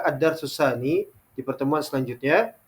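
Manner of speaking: ordinary talk, nothing marked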